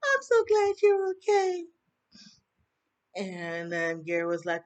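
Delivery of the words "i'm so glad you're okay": very high, loud put-on gush of relief; mock high-pitched relieved squeal. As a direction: imitate her gushing, pitched way up and held. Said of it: "was just like".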